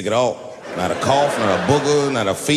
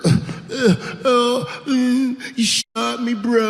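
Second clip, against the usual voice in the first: high whiny voice of a shot addict; dumb dying addict; 8 semitones higher, much slower than usual. Retold or imitated. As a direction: whimper it like a dopey dying junkie. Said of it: "stupid heroin last words".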